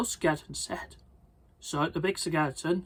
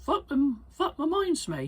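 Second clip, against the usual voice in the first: lifted high, light and thoughtful; high skeleton voice; 10 semitones higher than usual. Acted. Big skeleton recalls their plan, high-voiced. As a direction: remember something in a high voice. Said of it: "he said".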